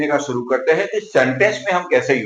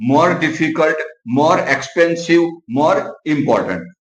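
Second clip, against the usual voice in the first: slow dictation; much slower than usual. Shown as a demonstration. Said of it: "जैसे कि more beautiful".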